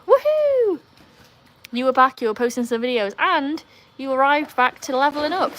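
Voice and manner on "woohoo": high, long whoop; celebratory cheer. Real, not acted. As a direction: whoop high for a friend's win. Cheering a viewer's level-up.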